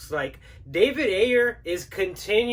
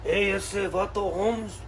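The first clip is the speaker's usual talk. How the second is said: put-on laid-back Chicano street accent, ese and vato; slower than usual. Sketch: Chicano tax collector at door.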